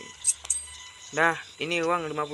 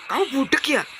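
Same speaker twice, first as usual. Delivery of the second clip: acted angry shout, pitched up; angry husband; 5 semitones higher than usual. Husband denying taking the savings, angry. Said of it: "Kok kamu malah marah?".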